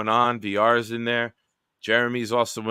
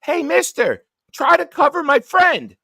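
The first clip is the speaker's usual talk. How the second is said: put-on high squeaky kid voice, much higher and louder; a kid's high-pitched yell; little kid voice; 8 dB louder than usual. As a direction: play a six-year-old calling out to a grown-up. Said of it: "And he goes".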